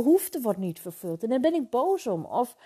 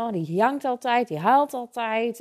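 mock someone for always crying; spottend nagedaan; 6 dB louder than usual. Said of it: "dat was wat er tegen mij zei". (spottend huilebalk-verwijt nagedaan)

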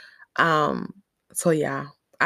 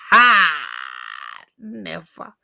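defiant refusal; 3 dB louder, much slower than usual. Retold or imitated. Daughter refusing mother's chicken order, defiant.

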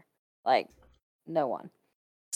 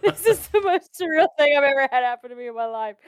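high, slurred through real laughter; laughing disbelief; 6 semitones higher, faster than usual. Real, not acted. Telling a friend about the spooky waitress, laughing. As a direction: laugh it out, barely believing it.

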